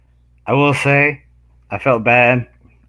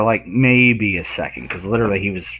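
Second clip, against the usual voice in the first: ordinary talk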